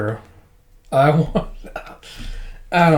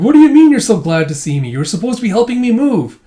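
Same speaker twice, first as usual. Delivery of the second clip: louder, exasperated wife; mock scolding; 7 semitones higher, 5 dB louder, faster than usual. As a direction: scold a useless husband, playfully acted. Wife scolds husband, retold.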